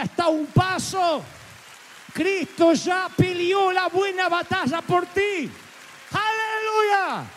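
high, slow shout; preacher shouting victory to the congregation; preaching shout. Real, not acted. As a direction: lift it up to a triumphant shout. Preacher's climax.

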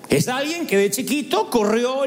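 ordinary delivery

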